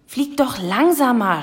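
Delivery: high, alarmed telling-off; mildly cross raven's squawked order; scolding. A narrator's reading. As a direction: scold the reckless flyer. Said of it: "schimpfte Abraxas".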